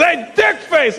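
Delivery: high mock holler; high-pitched imitated heckle; calling out insultingly. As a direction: yell the insult at a stranger. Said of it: "He goes".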